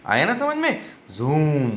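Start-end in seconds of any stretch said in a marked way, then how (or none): 1.1-1.7 जूम with a long, held "oo"; long vowel. Shown as a demonstration. Teacher contrasting the two sounds, जूम drawn out long. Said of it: "देखिए यहाँ पर double ओ का sound कैसा है".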